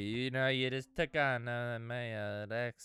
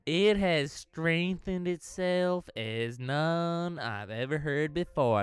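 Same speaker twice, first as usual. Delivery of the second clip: put-on southern accent; 3 semitones higher, 9 dB louder than usual.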